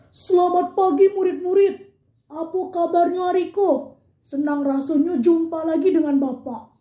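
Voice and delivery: hearty big voice greeting the class; big voice, teacher figure. Acted. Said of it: "itu suara besar".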